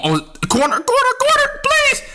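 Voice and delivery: very high, rising plea; hoping the shot goes in; desperate pleading. Real, not acted. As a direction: beg the ball to drop. Begging the shot in game.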